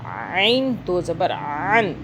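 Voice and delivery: عین and عن squeezed from the throat, drawn out slow; throaty ain. Shown as a demonstration. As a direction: hold عین long from the throat. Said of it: "حلق سے عین کی آواز کو نکالنا ہے". (عین حلق سے، کھینچ کر)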